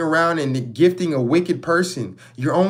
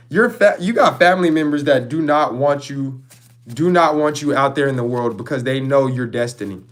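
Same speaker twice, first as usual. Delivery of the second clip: ordinary talk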